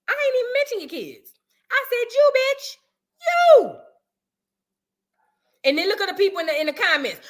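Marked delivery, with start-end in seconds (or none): 2.1-3.8 high, loud jabbing yell of "you"; hammed-up pointed yell; mocking yell. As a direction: fire each "you" at her, high and loud. Mocking her own insult.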